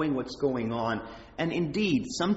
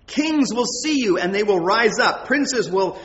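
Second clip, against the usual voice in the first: louder, higher declamatory reading; scripture proclamation; 5 semitones higher, 6 dB louder than usual. Acted. Reads scripture aloud with force.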